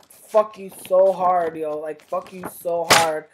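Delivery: loud, emphatic angry outburst; slow, forceful opening, hurled at the offender; real anger. Real, not acted. Telling off those who question one's blackness, furious. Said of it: "makes me fucking so mad".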